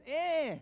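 short, high whining refusal; imitated toddler whine. Child rejecting the parent's guess, mock whine. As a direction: mimic a small child's wordless whine. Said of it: "whiny cry".

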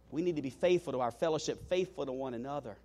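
ordinary talk, nothing marked

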